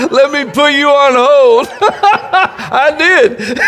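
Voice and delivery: can barely get it out for laughing; laugh-shouted lines; laughing through words. Real, not acted. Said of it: "I'm laughing and almost crying".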